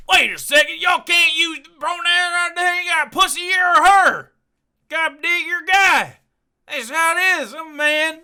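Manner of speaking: high-pitched mock protest; mock close-minded objector. Comedian parodying someone objecting to pronouns, high mock protest. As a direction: put on a high, indignant caricature voice. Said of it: "supposed to be the one that's like".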